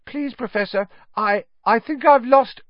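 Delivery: very high, stammering plea; nervous schoolboy. A narrator's reading. Neville confessing a lost form to his teacher, flustered.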